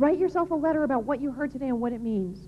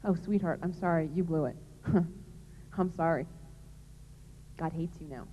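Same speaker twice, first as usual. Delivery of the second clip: softer, lower, falsely sweet pity; mock sarcastic sympathy; 8 semitones lower, 7 dB quieter than usual. Speaker mocks a false church reply to past sinners, softly sarcastic. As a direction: sarcastically pretend to console, then condemn. Mocking a cold church response.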